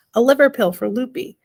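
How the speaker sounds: ordinary delivery, nothing marked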